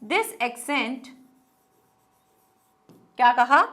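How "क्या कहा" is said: ordinary talk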